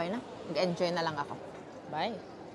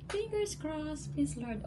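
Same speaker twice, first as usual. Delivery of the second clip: 1 tags only: hopeful plea; 9 semitones higher, 5 dB quieter, slower than usual. Real, not acted. Nervous prayer before result.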